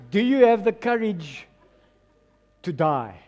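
ordinary talk

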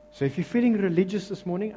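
normal talking voice

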